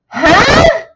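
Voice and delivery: loud, high-pitched mock confusion; baffled outburst. Viewer acts out reaction to rants in French, baffled. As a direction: blurt it high and loud, totally lost.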